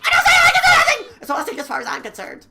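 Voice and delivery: shrill, very high, loud manic killer; cackling unhinged serial killer; high loud crazed villain; Genocide Jill voice. Acted. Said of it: "my voice has that much capacity to voice her voice".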